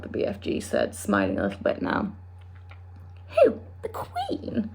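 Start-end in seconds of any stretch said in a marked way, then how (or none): 3.4-4.7 shocked, sharply high; aghast. Acted. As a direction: gasp it out in disbelief. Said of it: "Sophie cried aghast".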